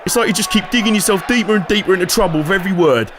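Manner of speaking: ordinary delivery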